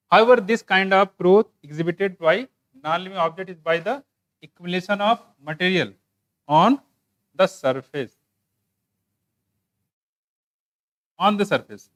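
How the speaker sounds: very slow, emphatic English dictation; textbook read-aloud. Real, not acted. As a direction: read it out chunk by chunk, with pauses. (रुक-रुककर, ज़ोर देकर पाठ पढ़ते हुए)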